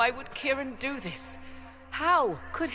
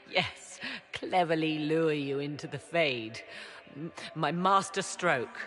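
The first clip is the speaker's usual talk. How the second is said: low, mocking agreement; bitter sarcasm; 7 semitones lower than usual. Mother accused of luring the Inquisitor, bitterly sarcastic. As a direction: pretend to confess with scorn.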